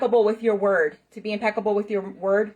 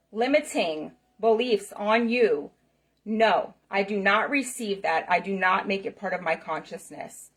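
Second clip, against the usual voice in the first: normal talking voice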